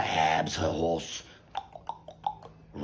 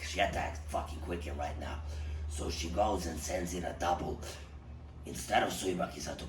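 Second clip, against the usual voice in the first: usual speaking voice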